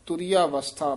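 ਤੁਰੀ ਅਵਸਥਾ read as one joined phrase. Shown as a demonstration. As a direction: run ਤੁਰੀ straight into ਅਵਸਥਾ. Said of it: "ਤੁਰੀਆ ਤੋਂ dash ਦੇਣੀ ਹੈ". (ਤੁਰੀ ਅਵਸਥਾ ਜੋੜ ਕੇ ਇਕੱਠਾ ਉਚਾਰੋ)

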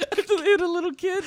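high, loud laughing; howling with laughter; laugh-shouting the words. Real, not acted. Laughing along.

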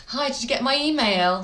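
lightly hammed-up phone enquiry; pestering caller. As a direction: imitate an over-eager caller chasing a reply. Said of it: "if you say".

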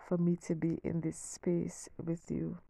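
ordinary talk

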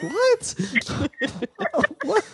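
high, laughing "what?"; laughing at the memory; laughing disbelief. Real, not acted.

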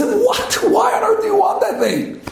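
high, incredulous outburst; shocked dad. Retold or imitated. Father shocked at son's wish.

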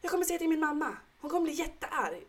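put-on high, childish scolding voice; angry little girl. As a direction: play the cross six-year-old threatening to tell mum. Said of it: "Hon bara".

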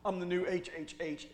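perky, lightly voiced self-introduction; Satan introducing himself to the secretary, lightly voiced. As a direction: greet the secretary with mock pride.

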